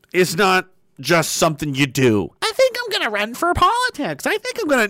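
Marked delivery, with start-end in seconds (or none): 2.4-4.9 much higher, dreamy would-be politician voice; mock naive politician. Mocking the people who run for office in a high dreamy voice. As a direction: lampoon a clueless wannabe politician.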